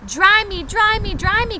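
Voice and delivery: put-on high, loud pleading baby chick; soggy chick crying. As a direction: beg to be dried, high and insistent. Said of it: "cried the soggy baby chick".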